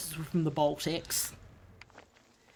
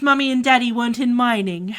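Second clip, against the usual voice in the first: lightly put-on posh Australian voice; a hint of rich-kid Australian accent, mocking; upper class Australian accent; 3 semitones higher, 4 dB louder than usual. Teasing rich people in a light posh Australian accent. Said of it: "as I can do the upper class one".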